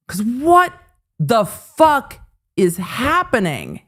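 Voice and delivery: slow, stretched "what the fuck"; drawn-out disbelief. Real, not acted. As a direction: let the words drag in shock. Repeats stunned catchphrase.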